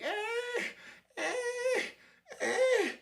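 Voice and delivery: high-pitched mewling wail; cat crying like a baby. Retold or imitated. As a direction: imitate a tomcat wailing like an infant. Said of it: "crying like a baby".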